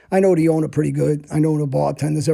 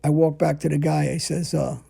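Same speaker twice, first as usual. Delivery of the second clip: ordinary delivery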